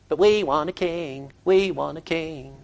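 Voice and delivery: low, soft nagging whine; petulant child. Acted. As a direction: nag quietly like a petulant child. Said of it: "it's like that petulant child that just keeps nagging".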